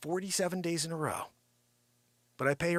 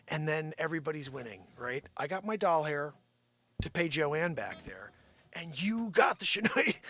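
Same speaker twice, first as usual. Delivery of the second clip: normal talking voice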